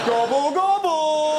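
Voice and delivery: loud, slow hammed-up gobble; mock gobbling. Host mocking kneeling priest, loud slow gobble. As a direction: shout it like a cheeky heckler.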